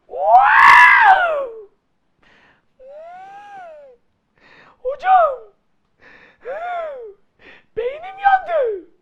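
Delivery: very high, loud shriek of shock; my brain is fried, squeal it out; overwhelmed shriek. Acted. Student overwhelmed by the rule.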